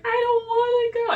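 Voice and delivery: high-pitched mock whine; imitated crying child. Mother imitating son refusing to go, mock crying. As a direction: whimper it like a little boy dreading the toilet. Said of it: "he would start crying".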